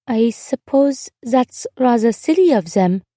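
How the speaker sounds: put-on slow, thoughtful girl's voice; said slowly. A narrator's reading. Girl weighing her neighbor's problem, speaking slowly. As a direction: draw it out carefully as a child. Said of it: "she said slowly".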